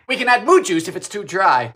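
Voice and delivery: ordinary talk, nothing marked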